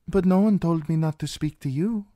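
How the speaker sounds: put-on high, light young princess; sweet, scheming young princess. A narrator's reading. Princess voiced in audiobook.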